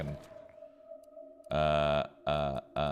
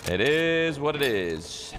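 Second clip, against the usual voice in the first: stretched slow and a bit higher; slow drawn-out resignation; 6 semitones higher, 3 dB louder, much slower than usual. Pretend. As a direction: draw it out, resigned and amused. Repeats the phrase drawn out.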